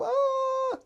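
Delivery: very high, drawn-out mock wail; whining child's wail. Child unable to sleep in the storm, high whiny wail. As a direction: squeal like a fed-up little kid. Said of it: "in my not-so-sweet voice".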